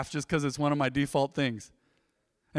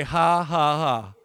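a spoken ha ha ha, dropping lower; mild demo laugh, trailing lower; 3 semitones lower, much slower than usual. Shown as a demonstration. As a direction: model the laugh for the congregation. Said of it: "Look at me and say".

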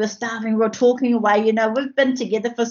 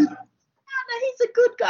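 high mock reassurance; 13 semitones higher than usual.

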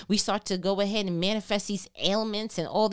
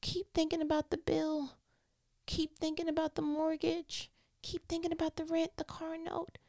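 soft, high, insistent voice; mock worried nagging; 5 semitones higher, 7 dB quieter than usual. Preacher voices the enemy's worries to listeners, soft mock fretting. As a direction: nag quietly on one high note, like creeping worries.

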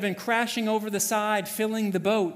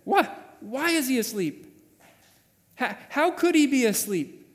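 higher, louder, indignant questioning; angry disbelief; 7 semitones higher, 5 dB louder than usual. Acted. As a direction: demand an answer in angry disbelief. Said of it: "is anger".

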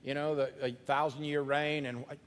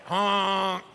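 goose honk; 3 semitones higher, 9 dB louder than usual. Shown as a demonstration. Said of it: "Say it with me".

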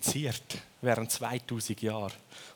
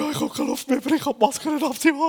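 mock panic; 9 dB louder, faster than usual. Panicking trainee in gas mask.

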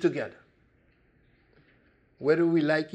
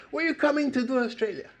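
fast, much higher hostile reporter voice; reporter's mocking question; 11 semitones higher, much faster than usual. Australian reporter grilling a visitor, fast and high. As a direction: fire it off like an attacking reporter. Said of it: "He said".

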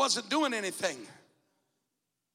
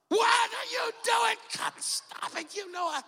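wife's alarm; 10 semitones higher than usual. Retold or imitated. Wife woken by sudden braking, shouting high in alarm. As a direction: play the wife jolted awake, shouting. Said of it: "you know how she reacted".

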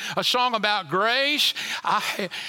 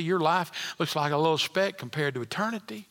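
usual speaking voice

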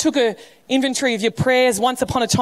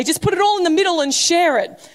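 mock Australian diner; 7 semitones higher than usual. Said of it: "mocking the way that Australians came".

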